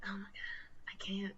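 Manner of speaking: whispered, worn out; exasperated whisper. Retold or imitated. Worker whispering her exasperation to herself. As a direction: breathe it out like you're done.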